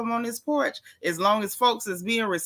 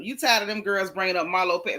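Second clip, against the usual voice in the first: normal talking voice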